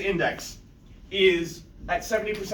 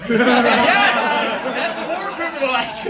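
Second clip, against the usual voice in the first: gleeful laughing shout; 5 semitones higher, 7 dB louder, slower than usual. Real, not acted. Gleeful agreement, loud.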